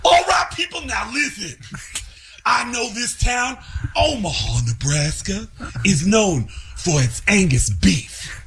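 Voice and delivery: [name] impression. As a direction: holler to the arena. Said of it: "[name] went".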